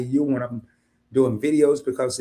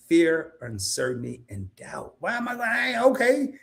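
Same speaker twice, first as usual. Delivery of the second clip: ordinary talk, nothing marked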